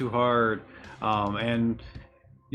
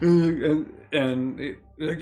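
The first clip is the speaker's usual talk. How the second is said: normal talking voice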